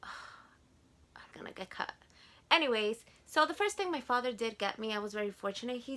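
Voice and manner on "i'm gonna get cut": sigh, then a near-silent whisper; whispered aside. Real, not acted. Vlogger to herself about the video being cut, whispered. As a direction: breathe it out as a worried aside. Worried aside, nearly inaudible.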